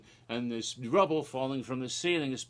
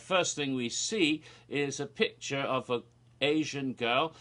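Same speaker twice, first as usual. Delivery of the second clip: normal talking voice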